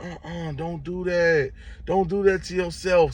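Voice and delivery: high, slow imitated plea; drawn-out high don't do that; mock high-pitched lady warning; pleading guard lady. Security guard lady pleads, retold.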